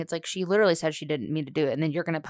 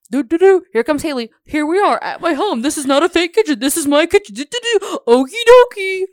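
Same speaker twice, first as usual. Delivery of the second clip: much higher and louder, a singsong mock of a bubbly influencer; clownish Haley impression; 8 semitones higher, 11 dB louder than usual. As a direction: ham up a chirpy home-tour host. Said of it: "it's a little bit clownish".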